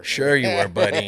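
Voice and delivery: playfully sarcastic brush-off; mock disbelief. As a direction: imitate friends humoring a tall tale. Boys doubting his story, acted out.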